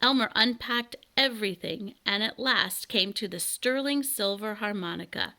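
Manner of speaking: ordinary delivery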